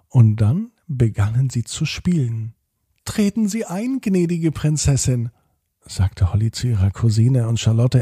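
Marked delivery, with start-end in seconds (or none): 3.0-5.3 lightly voiced, lofty princess greeting; a hint of a high-flown princess; posh princess talk. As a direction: play at being a grand lady, higher. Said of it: "mit einer hochgestochenen Sprache".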